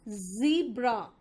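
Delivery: slow "ज़ीब्रा" with held z; teacher's slow model of "ज़ीब्रा"; zee sound shown. Shown as a demonstration. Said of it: "यहाँ पे जी आ रहा है".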